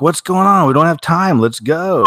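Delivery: put-on high, loud urgent yell at a kid. Parent yells at kid to leave the burning house, loud and high. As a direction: shout to hurry a child out of the house. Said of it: "You're like".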